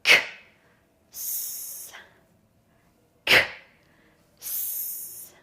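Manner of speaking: "K" and "S" far apart, voiceless; long pause between "K" and hissed "S"; slow, separated sounds. Shown as a demonstration. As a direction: sound out "K", wait, then "S". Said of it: "now we're gonna do it faster".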